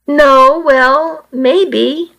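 high, hesitant and helpless; torn, searching for words. A narrator's reading. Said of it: "I answered helplessly".

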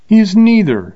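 high, sharp scornful retort. A narrator's reading. Girl scornfully answering the gunman Bill, sharp and high. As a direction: spit it out with scorn, loud and high. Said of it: "she replied scornfully".

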